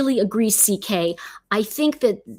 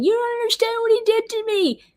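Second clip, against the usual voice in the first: mock scream; 13 semitones higher than usual. Woman retelling an accuser's act in court, mock screaming. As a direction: imitate a hysterical scream, pitched way up. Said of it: "she's screaming".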